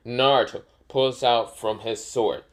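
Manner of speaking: ordinary delivery, nothing marked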